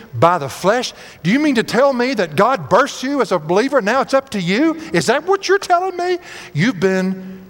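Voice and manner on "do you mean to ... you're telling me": high-pitched disbelief, climbing to the last question; incredulous challenge. Acted. Preacher confronts believers turning to works, high incredulous challenge. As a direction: question them in rising astonishment. Paul challenging the misled Galatians.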